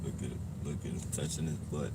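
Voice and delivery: muttered aside, then a loud call-out; calm turning angry. Real, not acted. Confronting a man on the street.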